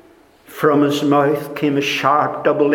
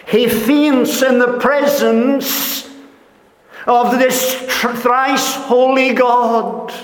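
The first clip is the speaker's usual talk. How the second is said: preaching at full force; 10 semitones higher than usual. Acted. Preacher proclaims to congregation that John faints, full-force preaching. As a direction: cry it out like a revelation. Preacher exclaims God's holiness.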